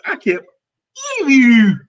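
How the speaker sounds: loud, high "believe you"; mock indignation, family style; household catchphrase. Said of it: "That's how we do it in my house".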